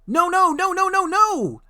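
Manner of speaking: very high, loud mocking trucks; defiant trucks. A narrator's reading. Silly trucks refusing to stop, squealing defiance. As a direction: squeal it back, naughty. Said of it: "answered the trucks".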